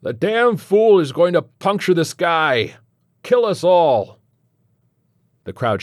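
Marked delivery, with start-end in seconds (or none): 0.0-4.2 loud, high, drawn-out shouting; alarmed heckler's yell. A narrator's reading. Red-faced man heckles the rocket launch, yelling out. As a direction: yell a warning of doom over the crowd. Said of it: "one man with a red face and an eye-watering stink yells out".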